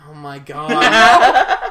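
loud slow laughing groan; laughing exclamation. Real, not acted. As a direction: drag it out through laughter. Reazione alla battuta KGB.

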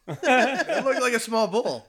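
high and loud, laughing through the words. Real, not acted. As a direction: crack up at the mix-up. Laughing at own mistake.

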